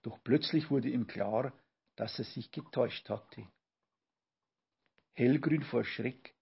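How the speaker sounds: usual speaking voice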